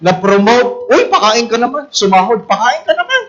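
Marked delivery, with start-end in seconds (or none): 0.9-1.9 wheedling demand. Acted. Acts friend demanding a treat. 2.5-3.2 high, loud-ish begging for a treat; acted eager nagging; wheedling demand. Acts friend demanding a treat.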